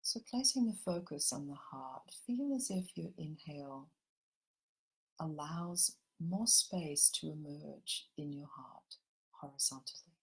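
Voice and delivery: gentle meditation guide drawing everyone into the heart; guided meditation. Acted. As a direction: ease the listeners into calm, unhurried.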